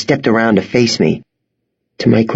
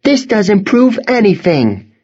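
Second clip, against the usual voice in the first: put-on dismissive girl; 11 semitones higher than usual. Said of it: "she said".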